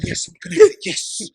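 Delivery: high, loud victory shout; triumphant cheer. Retold or imitated. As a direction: celebrate like you finally won. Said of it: "You're like".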